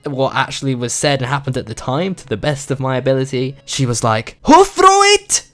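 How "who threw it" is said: put-on loud, high shriek in a Singapore-English accent; outraged, drawn-out Singapore-English accent shout. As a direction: imitate a furious mum yelling with a Singapore-English accent. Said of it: "in her Singapore slash English accent".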